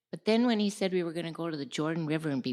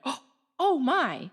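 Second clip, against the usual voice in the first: higher, louder, slow startled gasp of words; 8 semitones higher, 5 dB louder, slower than usual. Retold or imitated. [name] retelling the camel looking at her, startled. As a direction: caught off guard by something alive.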